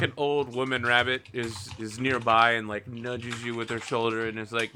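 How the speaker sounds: ordinary talk, nothing marked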